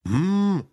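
high squeaking hum like a bird's beak; squeaky hum. Acted. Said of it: "пропищал нос".

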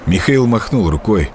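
ordinary delivery, nothing marked

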